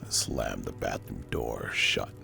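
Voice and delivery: usual speaking voice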